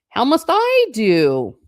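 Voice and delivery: high, loud, drawn-out question; exclaimed plea. A narrator's reading. Narrator's unanswered plea to household.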